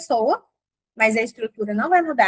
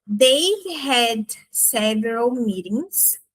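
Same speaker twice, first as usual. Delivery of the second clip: "They've had several meetings" said very slowly; teacher's slow model sentence; slow demo; much slower than usual. Shown as a demonstration. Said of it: "com they agora".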